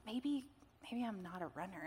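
soft, hesitant retold inner thought; hushed self-doubt. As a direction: let the doubt slip out quietly, haltingly. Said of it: "I just felt this sense of".

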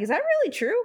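high, startled retold question; surprised disbelief. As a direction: pitch the doubt way up in mock shock.

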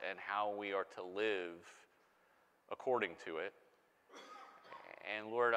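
soft, hushed pastoral prayer; gentle spoken prayer, dropping low at the close. Real, not acted. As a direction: pray quietly and sincerely for the congregation. Pastor prays before sermon.

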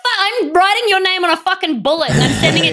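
mock rage. Mock threatening Riley over pedantry.

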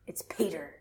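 whispered reveal of the name; hushed reveal. A narrator's reading. Storyteller revealing the intruder, whispered. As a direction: breathe it like a twist.

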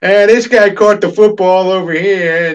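lightly imitating a cartoon sportscaster dad; mock football call, gently slowed; Peter Griffin style. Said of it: "he'll do it Peter Griffin style".

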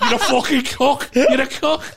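high, loud, laughing abuse; mock roast insult. Podcaster can't turn roasts into jokes, high laughing mock insults. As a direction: drag out the insult loudly through laughter.

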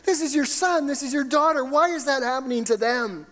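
high-pitched pleading challenge to God; impassioned protest. Acted. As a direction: cry out in protest, pitched well up. Voicing a believer's protest to God.

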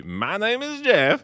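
put-on slow, high Spanish-accent line; mock Spanish accent with a rising end; fake Spanish accent. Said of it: "attempting to do a Spanish".

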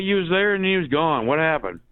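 ordinary talk

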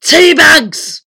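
high, loud booming answer; thunderous. A narrator's reading. Giant wizard explains how the teapot works, thunderous. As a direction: thunder out both words. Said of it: "came a thunderous reply".

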